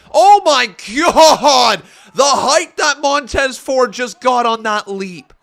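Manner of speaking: loud, slow, laughing disbelief; amazed shout. Real, not acted. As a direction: blurt it out in awe. Amazed by a huge leap.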